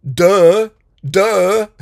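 loud scornful duh; mocking duh. Real, not acted. Mocking people who complain, loud sneering duh. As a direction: sneer it at the obvious.

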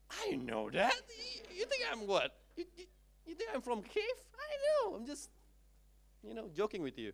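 very high, squeaky face-saving protest; mock-indignant bluff. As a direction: pretend you knew it all along. Embarrassed speaker bluffs to the CEO. (pura-pura tersinggung bersuara melengking)

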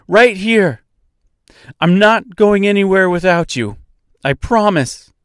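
high, loud reassurance; yelling over noise. A narrator's reading. Dorothy to injured Mister in the river, calling loudly. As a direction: call out a promise across the river. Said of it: "she yelled over the noise of the river".

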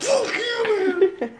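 very high, loud put-on yell; mock angry yell. Dan glaring at friends, mock angry yell. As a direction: snarl it at a friend for laughs. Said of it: "Dan would do it really well".

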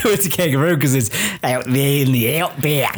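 a light, shaky Australian accent put on for fun; a halting Aussie accent, played for laughs; mock Australian accent. Host joking about the Australian outback, in a mock Australian accent. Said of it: "I don't know how to do the accent".

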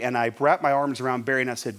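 normal talking voice

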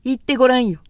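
loud defiant challenge; shout. Acted. Ohiro daring Okei to say it, loud shout. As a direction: fling the dare back at her. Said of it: "とおひろが叫んだ".

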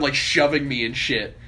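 ordinary delivery

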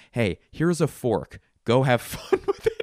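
fake-nice, then crack up laughing; mock friendly offer. Comic tells a careless parent's kid: sly mock-kind voice. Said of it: "I'm gonna be like".

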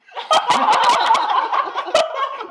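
loud high cackling laugh; burst of laughter. Real, not acted. Friends cracking up at the failed ASMR bit, loud high laughter. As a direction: howl with laughter.

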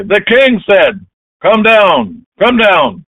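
high, loud officer relaying an order; shouted command. Acted. Captain passing on the king's order to Elijah, shouted command. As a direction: shout the order up the hill, drawn out.

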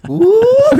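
loud, very high-pitched "woo"; sudden wired whoop while retelling. Retold or imitated. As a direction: let out a piercing, drug-buzzed whoop. Retelling high on drugs at wheel.

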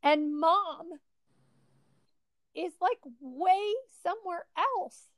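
on the edge of tears, holding it together; choked-up sadness. Real, not acted. Friend recalling little Brett crying on the floor, emotional and near tears. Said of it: "it makes me sad every time".

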